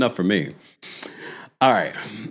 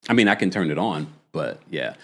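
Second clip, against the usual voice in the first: ordinary talk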